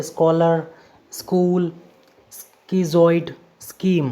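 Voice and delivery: स्कॉलर, स्कूल, स्कीजॉइड, स्कीम each with a hard sk. Shown as a demonstration. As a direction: read स्कॉलर to स्कीम one by one, k after the s. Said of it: "सीएच का उच्चारण होगा क". (स्कॉलर से स्कीम तक स के बाद क की ध्वनि, अलग-अलग)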